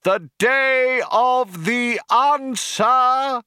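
high, loud, slow orator proclaiming; rally the crowd over a tannoy; crying out to crowd. A narrator's reading. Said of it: "cried the cheerleader".

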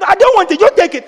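high, loud refusal. Retold or imitated. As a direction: shove the offer back. Pastor refusing again.

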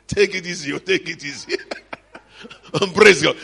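usual speaking voice

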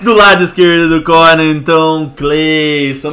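loud ring announcer call; boxing announcer. Pretend. Repeats the ring-style introduction.

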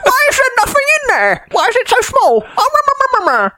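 very high, squeaky complaining voice; hammed-up falsetto moaning, dissolving into meh-meh babble; mock internet commenters. Co-host summarising internet comments, in a mock high-pitched complainer's voice. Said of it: "then I read internet comments".

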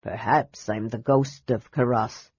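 put-on low, soft old dwarf voice; suggesting old dwarf. A narrator's reading. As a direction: offer it mildly, teasing. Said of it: "suggested the dwarf".

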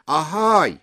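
high, loud hail; calling out. A narrator's reading. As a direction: call through a shut door. Calls to someone behind garage door. (صدا زدن با صدای بلند)

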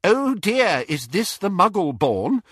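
high mimicked old witch; disdainful great-aunt. A narrator's reading. Young witch quoting rude great-aunt, high mock disdain. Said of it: "She said".

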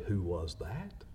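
soft, low reflective repeat; quiet. Shown as a demonstration. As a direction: say it softly and plainly. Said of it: "We don't want others to say".

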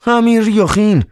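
very high, scornful; contemptuous. Acted. Ivan pointing out Riukhin with contempt, very high. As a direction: sneer his name. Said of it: "با تحقیر".

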